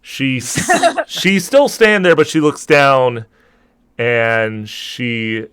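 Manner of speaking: usual speaking voice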